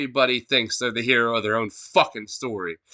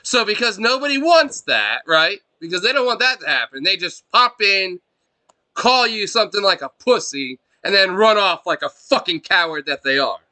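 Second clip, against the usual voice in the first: loud, high-pitched tirade; angry rant; 7 semitones higher, 5 dB louder than usual. Real, not acted. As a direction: spit it out with contempt. Ranting about players insulting and leaving.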